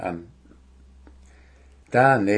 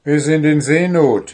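loud, higher alarm; urgent announcement; 6 semitones higher, 8 dB louder than usual. A narrator's reading. Dwarf explains the emergency to the drowsy owl, urgently.

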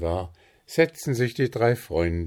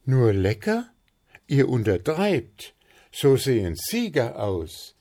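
higher, louder proud gnome; delighted; 6 semitones higher, 5 dB louder than usual. Acted. Puh shows friends his cake, proud, higher and louder. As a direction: crow with pride over the cake. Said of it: "freute sich Puh".

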